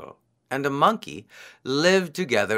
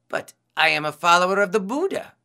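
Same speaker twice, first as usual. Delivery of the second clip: quick, high-pitched protest; objecting; 7 semitones higher, 3 dB louder, faster than usual. A narrator's reading. Disguised priest refusing the hare's offer, objecting. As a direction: protest briskly in a raised pitch. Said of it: "Saka objected".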